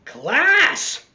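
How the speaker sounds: high, loud call to the class; teacher's call. Acted. Missus Dixon calls the class, high and loud. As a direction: call the room to order. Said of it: "said Missus Dixon".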